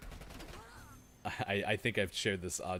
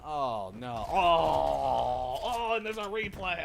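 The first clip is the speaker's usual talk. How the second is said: high, loud horrified reaction; shocked wincing; 6 semitones higher, 4 dB louder, slower than usual. Real, not acted. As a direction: recoil at the replay. Reacting to a gory replay.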